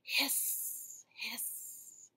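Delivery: airy hissing whistle of a kettle. Shown as a demonstration. Teacher showing children the tea kettle sound, hissing. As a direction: hiss out like steam. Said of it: "that will sound like this".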